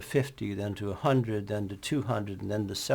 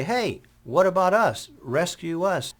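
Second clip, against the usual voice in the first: raised, higher call for help; calling out for rescue; 8 semitones higher, 4 dB louder than usual. Retold or imitated. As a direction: shout across at passing trucks. Stranded people calling to trucks.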